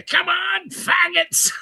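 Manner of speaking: high, drawn-out mock war cry; imitated battle cry. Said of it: "The famous battle cry".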